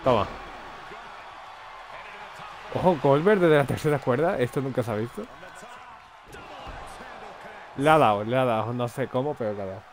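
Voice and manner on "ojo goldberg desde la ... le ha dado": excited ringside play-by-play; wrestling commentator. Acted. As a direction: call the big move live. Narra el combate como comentarista. (narrador de lucha libre emocionado)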